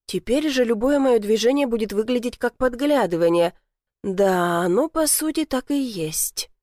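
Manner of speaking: ordinary delivery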